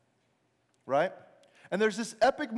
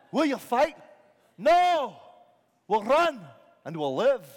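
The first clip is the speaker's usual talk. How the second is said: battlefield exchange; 2 semitones higher, 6 dB louder, slower than usual. Acted. Army answering its leader before battle, shouted. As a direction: call it out across the ranks.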